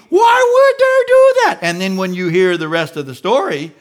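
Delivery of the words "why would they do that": high, loud indignant outcry; cocky outraged shout. Retold or imitated. Preacher mocking a self-righteous gossip, loud indignant shout. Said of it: "yesterday you were all cocky".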